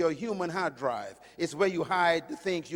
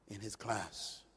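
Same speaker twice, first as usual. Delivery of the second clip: ordinary talk, nothing marked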